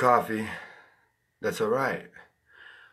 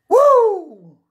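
loud high whoop; hype yell; 15 dB louder than usual. Real, not acted. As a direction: shout a wild whoop. Hype yell before slogan.

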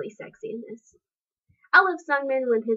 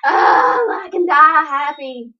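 overjoyed shout; 10 dB louder, slower than usual. Real, not acted. Fan shouting at her idol's video, overjoyed. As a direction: cry out as if you could die happy.

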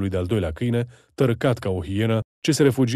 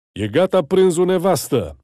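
high, loud call to the kitchen; 7 semitones higher, 5 dB louder than usual. A narrator's reading. Sabo Tibor home for lunch, calling loudly to his wife. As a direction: call through the house to the wife.

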